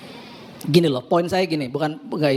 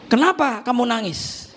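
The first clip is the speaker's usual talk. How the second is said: higher, slower gentle question; asking again; 6 semitones higher, slower than usual. Retold or imitated. Husband asking his crying wife again, higher and slower. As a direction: lift the voice and slow down, puzzled.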